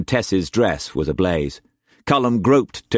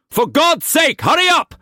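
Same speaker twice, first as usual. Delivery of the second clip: high, loud, rising to a yell; desperate shout; 5 dB louder than usual. A narrator's reading. Cullum shouting at Tess to hurry, desperate. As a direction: scream for speed.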